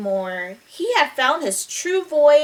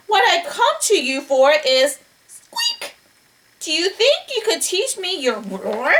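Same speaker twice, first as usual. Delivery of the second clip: higher-pitched, polite mouse voice; little mouse; 6 semitones higher than usual. Acted. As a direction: pipe up to get a big lion's attention. Mouse addresses the sleeping lion.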